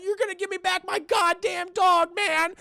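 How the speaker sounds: high-pitched indignant cartoon slacker; Shaggy. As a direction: demand it in a high sidekick voice. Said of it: "He's like".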